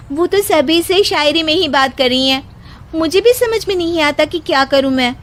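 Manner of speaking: ordinary delivery, nothing marked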